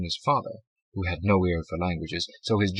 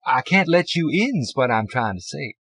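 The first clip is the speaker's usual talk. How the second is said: clerk voice; 6 semitones higher, 6 dB louder than usual. A narrator's reading.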